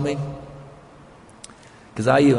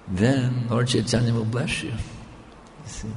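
usual speaking voice

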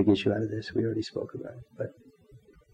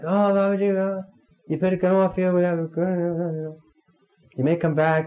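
upset pleading; 6 semitones higher than usual. Retold or imitated. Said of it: "she was all upset".

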